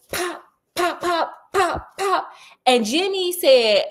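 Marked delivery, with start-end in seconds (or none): 0.1-2.3 lash sound effects. Retold or imitated. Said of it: "He's like".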